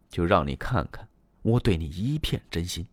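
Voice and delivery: ordinary delivery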